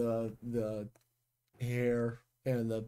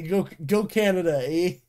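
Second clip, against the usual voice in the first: mock Canadian cheer; 5 semitones higher, 6 dB louder than usual.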